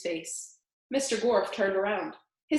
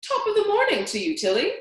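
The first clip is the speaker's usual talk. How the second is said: high, loud, fast Scottish greeting to his wife; old Scottish man; 13 semitones higher, 6 dB louder, faster than usual. Acted. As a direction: burst out joyfully to Tilly. Scottish man speaks after twenty years.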